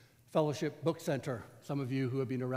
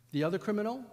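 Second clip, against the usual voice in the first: normal talking voice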